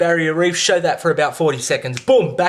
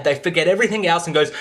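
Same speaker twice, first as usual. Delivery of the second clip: ordinary delivery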